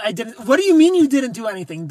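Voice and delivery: indignant outburst. Acted. Scolding Amanda for denying it, indignant loud outburst.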